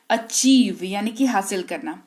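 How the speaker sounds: ordinary talk, nothing marked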